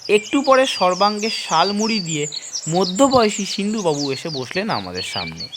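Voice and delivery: ordinary delivery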